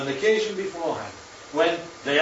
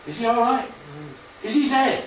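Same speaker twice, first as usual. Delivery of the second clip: anxious questions; 8 semitones higher than usual. Retold or imitated. People outside the house ask the young rabbi, anxious and rising. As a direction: press for the news, voice climbing with worry. Retold joke, crowd asks anxiously.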